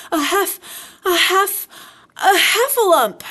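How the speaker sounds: high, loud, halting stammer; breathless Piglet. A narrator's reading. Piglet tells Christopher Robin, breathless and stammering. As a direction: puff out broken bits, then burst out the word. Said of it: "breathing so hard that he could hardly speak".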